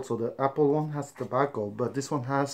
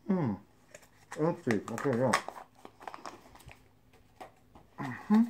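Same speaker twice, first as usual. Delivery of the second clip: speaking around lip pouch; slightly muffled lip sounds; snus under lip; 3 dB quieter, faster than usual. Real, not acted. Seen 1: put pouches in lip before; adds another at 6.7s.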